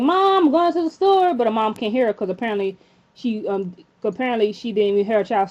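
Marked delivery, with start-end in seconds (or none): 0.0-1.3 higher-pitched call out to mom; mock yell. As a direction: imitate a daughter yelling to her mother. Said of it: "screams like".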